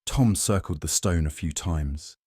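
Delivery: ordinary delivery, nothing marked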